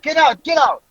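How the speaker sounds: high, urgent retold yell; shouting. Cab driver shaking two passed-out passengers, shouting. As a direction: imitate a driver yelling passengers awake.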